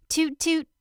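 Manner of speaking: a sister yelling a teasing toot; mocking yell. A narrator's reading. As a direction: taunt him from the top of the stairs. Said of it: "yells his sister".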